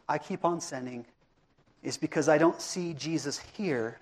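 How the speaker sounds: hushed confession. Real, not acted. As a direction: drop to a quiet, personal admission. Personal confession after restating the point.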